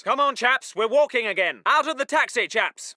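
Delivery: low, genial officer chivvying his men; easygoing order. Acted. Officer walking chaps from the carrier, relaxed and jokey. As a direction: coax them along casually. Calm version of same order.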